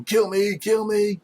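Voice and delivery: high-pitched screamed plea, held back in volume; mock scream. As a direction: act out a crazed man screaming. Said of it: "he starts screaming at you".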